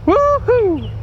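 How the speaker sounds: high, loud victory whoop. Acted. Mock hunter cheering his turkey kill, loud high whoop. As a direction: whoop like you just bagged the bird.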